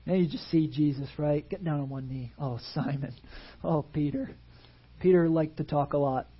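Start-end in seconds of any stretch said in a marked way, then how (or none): 2.4-4.3 soft, low, tender calling of a friend; tender plea. Retold or imitated. Jesus on one knee, calling Peter softly and tenderly. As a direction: kneel and gently plead his name.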